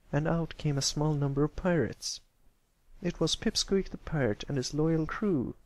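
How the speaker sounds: ordinary delivery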